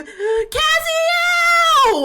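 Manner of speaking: high, loud, sung-out call; theatrical long-held shout; Astra. Shown as a demonstration. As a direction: belt the name out, drawn long and high. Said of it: "When I wanna be Astra, I have to do".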